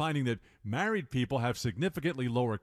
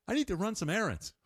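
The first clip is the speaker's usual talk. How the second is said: higher sing-song chore talk; baby talk to a dog; 8 semitones higher than usual. Shown as a demonstration. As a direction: say it like cooing at a puppy. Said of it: "not my imitation".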